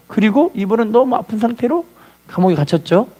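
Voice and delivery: ordinary talk, nothing marked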